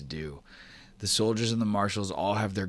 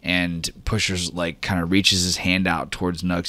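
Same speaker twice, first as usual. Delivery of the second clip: ordinary delivery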